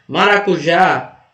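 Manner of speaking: "Maracujá" slowed, landing on a strong JÁ; teacher sounding out "Maracujá", JÁ strongest; stress on last syllable. Shown as a demonstration. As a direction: draw out the final JÁ of "Maracujá". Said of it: "É o já".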